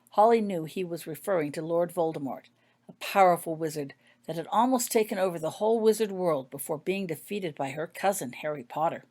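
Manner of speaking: ordinary delivery, nothing marked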